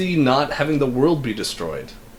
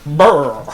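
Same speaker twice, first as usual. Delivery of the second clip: louder playful monster growl; mock monster roar; 6 dB louder than usual. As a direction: growl like a kaiju. Imitating Godzilla noises.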